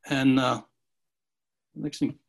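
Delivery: ordinary talk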